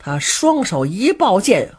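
usual speaking voice

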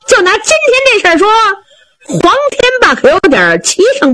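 high, fast, laughing braggart voice; cocky sidekick angling for praise; boastful Zhao Bi. Acted. Zhao Bi bragging to Lord Shi.

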